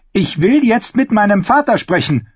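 high, commanding princess demand; strict command tone. A narrator's reading. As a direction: order it like a royal command. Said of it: "in ihrem strengsten Befehlston". (im strengsten Befehlston)

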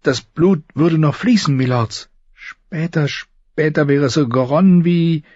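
nervous, high, halting voice; high and hesitant, feeling for each word; Samwell Tarly. Acted. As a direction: stammer timidly to a superior. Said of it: "Sams nervöse, hohe Stimme".

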